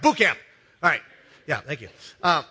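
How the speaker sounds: ordinary delivery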